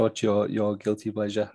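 ordinary delivery

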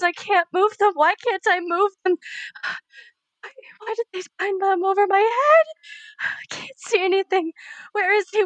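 high, anguished cries of a bound captive; pained terror. Acted. Entranced medium screams through a captive's pain. Medium relives torture in trance.

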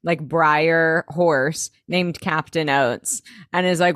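normal talking voice

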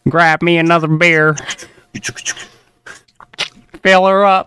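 put-on Texas accent. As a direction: put on a good-old-boy Texas voice. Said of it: "has to be is the Texas".

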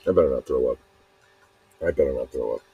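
low, strained queasy voice; nauseous. Real, not acted. Feeling sick while talking, queasy and strained. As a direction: hold back the urge to vomit. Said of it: "I feel like I'm gonna throw up".

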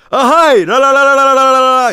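a yes melting into a la-la giggle; imitated giggle. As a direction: answer with a bright la-la laugh. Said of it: "言うて".

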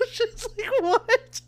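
high, laughing through the words; can barely get it out for laughing. Real, not acted. Telling friends the story, laughing high through the words. Laughing while quoting nurse.

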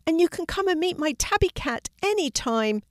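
put-on high-pitched child's voice; eager little girl. A narrator's reading. Daisy invites classmate to meet her cat, eager and high. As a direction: chime in as a keen classmate.

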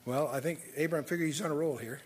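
ordinary talk

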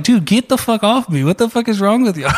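retold shout at the bystanders holding him down; retold yell. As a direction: bark it at the people pinning you down.

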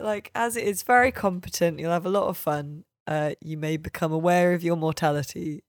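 normal talking voice

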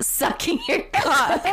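giggling through the line; can't keep a straight face; laughing. Acted.

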